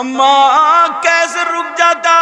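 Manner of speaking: high, loud, slow wailing sermon half sung; tearful preaching. Acted. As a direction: preach the son's grief at full force. Preacher at full force. (ऊँची, धीमी, रोती हुई तक़रीर)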